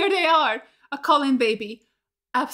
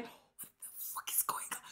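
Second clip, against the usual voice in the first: whispered in shock; stunned whisper; 18 dB quieter than usual. Real, not acted. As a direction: breathe it out in a whisper. Whispered aside after scare.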